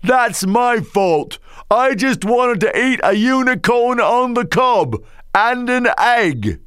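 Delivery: put-on high very slow drunk. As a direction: drawl it like a barfly. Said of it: "Then the drunk says".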